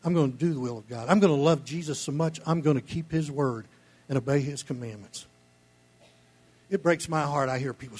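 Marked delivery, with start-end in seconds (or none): none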